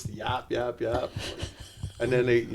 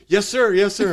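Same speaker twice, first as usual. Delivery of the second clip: higher, louder quick yes sir; meek submission; 8 semitones higher, 7 dB louder than usual. Retold or imitated. Humbled man answering after spanking, meek. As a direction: beg like a beaten man.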